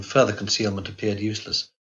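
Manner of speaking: ordinary delivery, nothing marked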